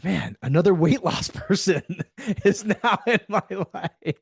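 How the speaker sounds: high, laughing exasperation at his own luck; laughing disbelief. Retold or imitated. Telling Colton about the cop client, laughing. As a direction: laugh through the words, amused and fed up.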